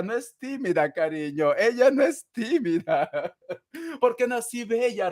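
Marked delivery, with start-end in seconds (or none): 1.6-5.1 high, laughing tease. Real, not acted. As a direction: laugh through the line. Laughing while voicing the viewer.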